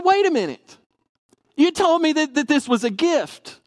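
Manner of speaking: high-pitched mock objection, easing down; retold indignant protest; taken-aback protest. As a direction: call out the broken promise in surprise. Retold protest at being asked to pay.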